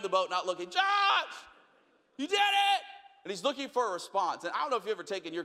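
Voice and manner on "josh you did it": much higher, louder excited yell; a proud dad shouting across the water; dad yells his son's name, much higher and louder. Retold or imitated.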